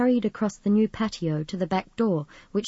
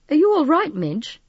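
high, louder worried question; mildly anxious, raised pitch; 9 semitones higher, 6 dB louder than usual. A narrator's reading. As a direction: check on a friend with concern. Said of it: "he asked anxiously".

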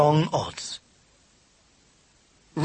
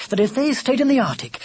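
ordinary delivery, nothing marked

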